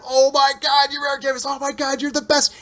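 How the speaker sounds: high, excited fan gushing over his hero; starstruck gush. Retold or imitated. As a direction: gush at him like a starstruck kid.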